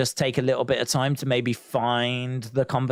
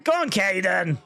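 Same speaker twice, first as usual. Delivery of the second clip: shouted encouragement; a fan's shout at the screen; cheering on; 4 semitones higher than usual. Real, not acted. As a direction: yell him on like a fan. Cheering on a wrestler.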